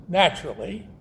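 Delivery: ordinary talk, nothing marked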